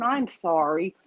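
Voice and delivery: ordinary delivery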